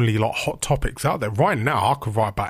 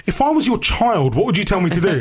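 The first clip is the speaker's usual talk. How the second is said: pleading; 9 semitones higher, 5 dB louder than usual. Retold or imitated. Acted plea to the interviewer.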